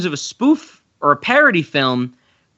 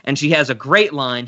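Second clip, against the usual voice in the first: ordinary talk, nothing marked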